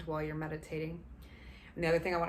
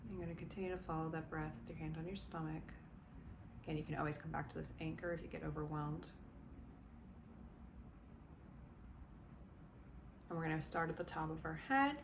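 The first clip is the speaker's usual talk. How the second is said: guided meditation. Acted. Coach guiding listeners through body relaxation, gently soothing. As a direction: ease them down into calm, step by step.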